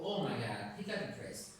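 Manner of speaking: sinking low and breathy; depressed, low voice. Shown as a demonstration. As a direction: let the voice drop into gloom. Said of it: "what would I have to notice in your voice".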